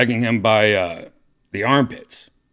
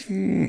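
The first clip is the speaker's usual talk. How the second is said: much higher cheerful hum; comedy mask hum; 12 semitones higher than usual. Shown as a demonstration. Describing the comedy mask, high bright hum. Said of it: "one is like".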